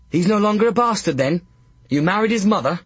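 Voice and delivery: high, fast, mock surprise; mock astonishment. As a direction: feign amazement to needle him. Fool mocking the earl.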